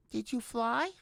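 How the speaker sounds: high, tender coaxing; gentle to animal. A narrator's reading. Brutha coaxes the tortoise, high and gentle. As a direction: talk to a small creature kindly.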